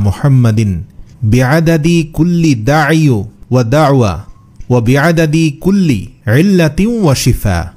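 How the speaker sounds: very slow, measured durud recitation; Arabic prayer recitation. Shown as a demonstration. Reciting the durud for viewers to memorise, slow Arabic recitation. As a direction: recite the Arabic slowly, drawing out the vowels. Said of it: "পাঠ করে আপনাদের শোনাচ্ছি".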